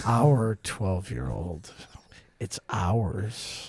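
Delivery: slow whispered creep; creepy whisper. Retold or imitated.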